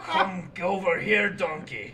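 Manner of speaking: put-on Scottish-accented ogre; hammed-up Scottish ogre voice; Shrek impression. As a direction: call the donkey in mock Scots. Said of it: "line from Shrek where it's like".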